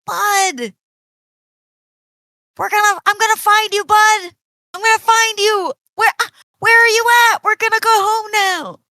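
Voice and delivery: very high, loud, rushed cries; excited calling out. Acted. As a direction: shout to a lost friend you've just found. [name] excitedly calls to lost Bud.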